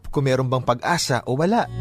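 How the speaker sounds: ordinary delivery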